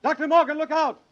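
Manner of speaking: desperate shouted warning; urgent warning shout. Acted. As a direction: scream a warning to him. Warning shout from cliff base.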